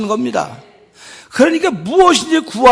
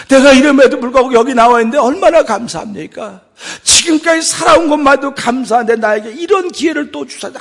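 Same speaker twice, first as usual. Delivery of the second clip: louder, crying-out prayer of thanks; tearful thanks; 5 semitones higher, 6 dB louder than usual. Acted. Believer thanking God for another chance, crying out. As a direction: pour out gratitude through tears.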